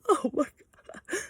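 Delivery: high, drawn-out tearful gasp of joy; mock happy crying. Imitating people crying when happy, mock tearful sob. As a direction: imitate someone sobbing with happiness. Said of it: "people get happy and go".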